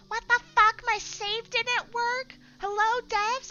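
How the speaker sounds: very high, loud whining gamer; mock whiny outrage. Streamer mocking players whose save broke, loud high whine. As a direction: whine at the devs in a shrill mock voice. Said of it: "they're gonna be like".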